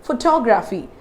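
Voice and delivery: "photography" as pho-TOG-raphy; careful model "photography", second syllable stressed. Shown as a demonstration. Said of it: "I'm stressing on the second syllable".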